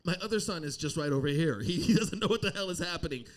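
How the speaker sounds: usual speaking voice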